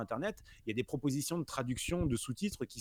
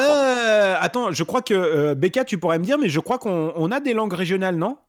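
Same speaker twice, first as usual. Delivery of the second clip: loud and high, calling across to a distant listener; to someone hard of hearing; 6 semitones higher, 13 dB louder than usual. Real, not acted. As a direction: project the voice so she can hear. Calling to Becca on weak line.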